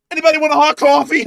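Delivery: high, very loud hollered offer; full-volume imitated yell. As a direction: holler it across a gas station. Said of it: "he just keeps yelling that".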